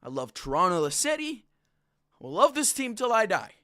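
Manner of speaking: usual speaking voice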